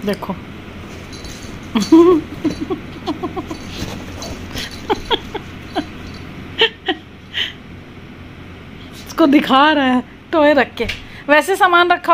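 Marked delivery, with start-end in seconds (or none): 0.4-7.0 breathy silent laugh. Real, not acted. Laughing at the pets.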